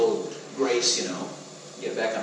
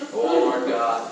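slow prayer, a bit less showy; toned-down mock prayer; 3 semitones lower, 6 dB louder, much slower than usual. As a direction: pray humbly but still performing. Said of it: "wasn't quite as loud as last week".

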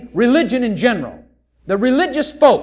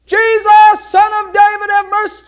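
high, loud pleading call; loud desperate plea; crying out; 11 semitones higher, 8 dB louder than usual. Acted. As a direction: beg at the top of the voice.

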